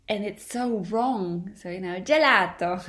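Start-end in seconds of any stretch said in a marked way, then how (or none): 2.0-2.9 "gelato" in a hammed-up fake Italian accent, louder. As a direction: play a tourist faking Italian on "gelato". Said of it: "with the fake Italian accent".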